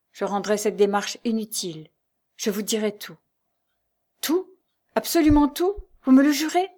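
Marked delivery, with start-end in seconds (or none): 4.2-6.7 high, loud, rising questions; eager insistence. A narrator's reading. Lucie making Jeanne swear to tell all, urgent. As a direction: press eagerly for a promise. Lucie begs for a sworn promise.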